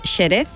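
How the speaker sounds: "Jerez" begun with a sh sound; old X pronunciation. Shown as a demonstration. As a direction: say "Jerez" as "sheré", sh for the j. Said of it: "Nadie dice Jerez".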